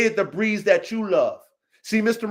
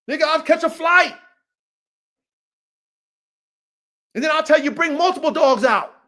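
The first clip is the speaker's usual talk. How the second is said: raised, higher-pitched trash talk; passionate challenge; 5 semitones higher than usual. Real, not acted. Coach daring the OG to bring dogs and money, passionate. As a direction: throw down the challenge with fire. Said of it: "the coach got the passion".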